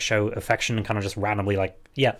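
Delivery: ordinary talk, nothing marked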